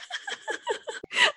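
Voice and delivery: high, soft burst of laughter; real giggling. Real, not acted. Host teasing co-host's easy listening voice, high helpless laugh. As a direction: giggle helplessly at your own tease.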